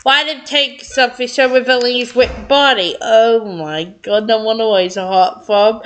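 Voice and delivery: plain reading voice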